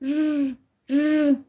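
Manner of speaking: र as a short rolled r, no vowel; just the r, no a after it; र as a clipped r with no vowel; bare r sound. Shown as a demonstration. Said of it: "इसका sound र नहीं होता है".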